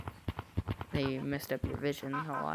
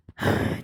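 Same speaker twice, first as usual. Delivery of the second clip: exasperated sigh; heavy annoyed exhale; loud frustrated sigh; 7 dB louder than usual. Real, not acted. Frustrated after flubbed take.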